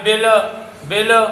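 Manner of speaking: lam bounced into a short vowel in بیلا; mispronounced qalqalah. Shown as a demonstration. Teacher shows the wrong pronunciation, bouncing the lam. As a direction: say بیلا with an echo after the lam. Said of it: "ایسے تلفظ غلط ہوگا".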